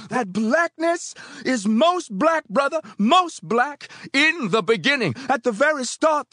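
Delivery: high, loud, drawn-out church response; congregation crying out. A narrator's reading. Congregation answers the preacher, crying out. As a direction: cry it back at full pitch. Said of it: "they cried".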